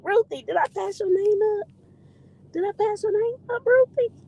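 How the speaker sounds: high, singsong questioning; squeaky-high query to a friend; high-pitched fussing. Real, not acted. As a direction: pitch it way up, playfully checking. Playful worry over skipped viewer.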